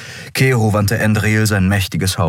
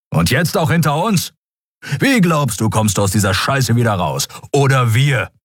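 a touch of raised, angry voice; getting louder; 8 semitones higher than usual. A narrator's reading. Keru grows louder at Kai, rising anger. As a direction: press him harder, voice rising. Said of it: "Keru wurde wieder lauter".

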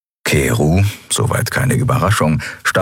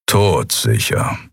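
low, slow gruff grumble; 5 semitones lower, slower than usual. Acted. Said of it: "brummte Keru".

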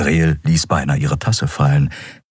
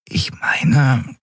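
whispered, sleepy mumble; half-asleep mumble; 8 semitones higher than usual. Acted. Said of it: "murmelte etwas Unverständliches im Halbschlaf".

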